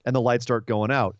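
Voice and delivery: ordinary talk, nothing marked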